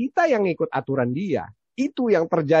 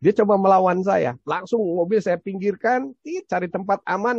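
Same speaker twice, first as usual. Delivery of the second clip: ordinary delivery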